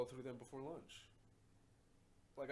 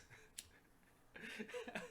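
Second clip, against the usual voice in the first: breathy laugh. Real, not acted. Laughing at own joke.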